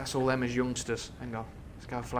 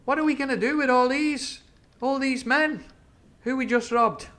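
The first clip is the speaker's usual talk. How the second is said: put-on high, loud eager young pirate; 13 semitones higher, 10 dB louder than usual. Young pirate asking his crew about the captives, high and loud. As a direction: call out like an eager young buccaneer. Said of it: "young Long John".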